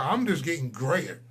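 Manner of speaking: normal talking voice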